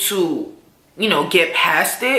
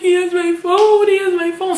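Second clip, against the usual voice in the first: distraught wail; 11 semitones higher, faster than usual. Retold or imitated. Crying after the robbery.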